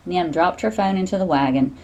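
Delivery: plain reading voice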